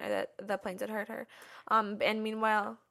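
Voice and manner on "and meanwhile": ordinary delivery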